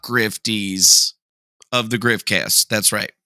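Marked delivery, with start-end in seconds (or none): none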